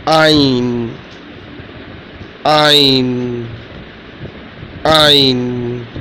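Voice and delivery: guttural Ain from the throat. Shown as a demonstration. Teacher showing pupils a letter, Ain drawn from the throat. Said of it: "Listen carefully".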